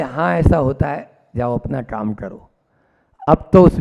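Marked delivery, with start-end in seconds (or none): 0.0-2.5 low, soft, unmoved reply; calm indifference. Retold or imitated. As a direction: dismiss the news quietly, voice dropping. Unmoved merchant answers excited clerk.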